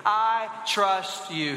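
very slow, word by word; spaced-out group recitation pace; leading a recitation. Shown as a demonstration. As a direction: draw it out so everyone can join in. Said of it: "Say that with me".

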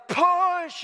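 high, drawn-out mock yell; retold yell. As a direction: imitate a trainer yelling, pitch way up. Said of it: "he's yelling".

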